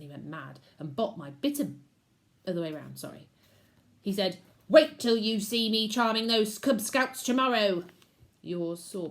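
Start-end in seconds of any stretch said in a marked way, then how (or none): 4.7-7.8 loud, smug boast; boastful wolf uncle. A narrator's reading. Uncle promising to charm the scouts, boastful. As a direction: brag about tomorrow's trick.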